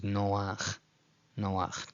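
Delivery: noach slowly as no-AKH, no diphthong; two separate vowels. Shown as a demonstration. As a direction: keep the o and a of noach apart. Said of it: "don't treat this as a diphthong".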